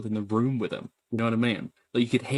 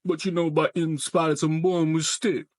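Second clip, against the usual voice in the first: higher put-on Southern voice; Southern accent; 5 semitones higher than usual. Streamer showing off the accent, higher Southern voice. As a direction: lay on the Southern accent, pitched up. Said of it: "that was a pretty good accent".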